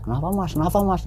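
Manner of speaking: high-pitched worried bystander, imitated; retold startled question; alarmed asking. Alarmed passers-by knocking on car.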